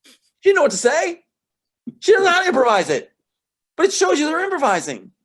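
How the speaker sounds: high, loud burst of enthusiasm; excited. Real, not acted. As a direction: push it up with delight. Animated explanation of the scene.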